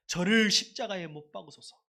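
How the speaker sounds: loud cry demanding crucifixion; crowd's outcry. Acted. Preacher voicing the crowd that turned on Jesus, loud outcry. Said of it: "우리 똑같이 외쳐봐야 합니다".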